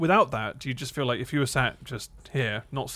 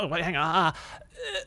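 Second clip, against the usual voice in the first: higher startled words into a strained squeak; mock dying panic; 4 semitones higher, slower than usual. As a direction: act out a body suddenly failing. Acting out accidental organ shutdown.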